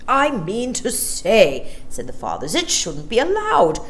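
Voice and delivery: gruff, gritty disapproving grumble; gruff fathers. Acted. Shopping fathers disapprove, gruff gritty grumble. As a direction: harrumph like a stuffy father. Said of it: "voices unnaturally gruff and gritty".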